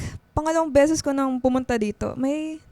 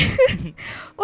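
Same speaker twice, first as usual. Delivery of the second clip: high breathy squeal of a laugh; squeal, caught out and embarrassed; 11 semitones higher than usual. Acted. Teased about the aquarium story. (mataas na tili ng hiyang tawa)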